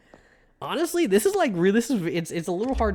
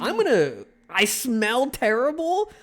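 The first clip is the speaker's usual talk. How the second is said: higher, slow, scandalized question; mock offended; 7 semitones higher, 4 dB louder, slower than usual. Player mock-offended by the game's remark, high and slow. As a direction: act insulted by the game. Game says to be careful.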